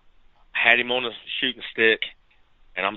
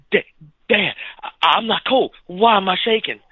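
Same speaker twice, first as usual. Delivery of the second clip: put-on higher, stammering kid voice, trembling after the shot; imitating shaky voice; 8 semitones higher than usual. Hunter imitates his young son shaking after his first deer, stammering higher. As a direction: act out a son's trembling, stuttering question. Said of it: "he's trying to talk to me like this".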